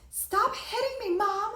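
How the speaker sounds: put-on very high, loud, drawn-out child's wail; drama-queen child. Mother mimics daughter slamming the door, high dramatic yell. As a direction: imitate a small girl crying out in mock outrage. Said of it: "she's a real character".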